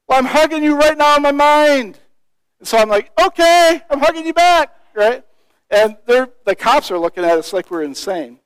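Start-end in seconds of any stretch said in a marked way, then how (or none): 0.1-2.0 imitated high, loud call; calling across a distance. Friend calling an imagined hug across the gates, shouting. 3.2-4.7 high raised-voice reply, acted out; calling back. Preacher answering his friend across the gates, calling out.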